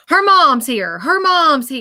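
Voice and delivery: high, loud, repeated call; excited announcement. Retold or imitated. Medium relays that a mother has arrived, excitedly. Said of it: "And I hear".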